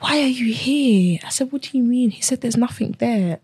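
high, choked-up retelling; trying not to cry. Real, not acted. Guest quoting the scan man, trying not to cry. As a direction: hold back tears, pitch lifted. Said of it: "I'm actually trying not to cry".

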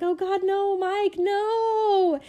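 high, loud exasperated plea; exasperated pleading. Retold or imitated. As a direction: beg Mike to stop, half laughing. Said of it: "And I was like".

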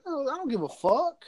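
retold mad backtalk. Mouthing off to the trooper about jail, mad. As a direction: imitate yourself talking back to a cop. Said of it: "that's when I got mad".

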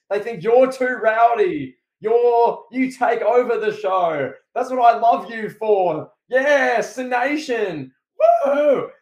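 loud, high, hammed-up crowd promo; Cena promo voice. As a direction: shout it to the arena like a superstar. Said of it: "He's like".

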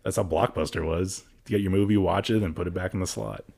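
ordinary talk, nothing marked

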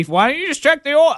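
high, mocking commenter voice; mocking YouTuber. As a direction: sneer it like a know-it-all viewer. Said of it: "I see you YouTubers slamming me".

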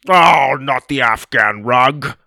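loud, slow wail of dismay; dismayed cry. A narrator's reading. Grandpa seeing goo on his rug, dismayed cry. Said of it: "Grandpa cried".

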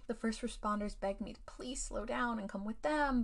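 usual speaking voice

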